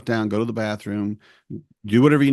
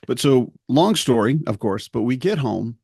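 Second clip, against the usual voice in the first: ordinary talk, nothing marked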